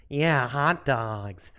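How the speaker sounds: slow, stretched Midwestern imitation; imitated Midwestern accent. Streamer mimicking the caller about hot dogs, imitated Midwestern accent. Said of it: "Pretty good Midwestern".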